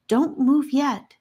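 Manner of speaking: put-on a small child's high voice, slow; little voice warning. A narrator's reading. Daldis warns the captives, a little high voice. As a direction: warn them gently. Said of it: "the little voice warned".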